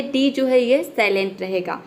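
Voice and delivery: normal talking voice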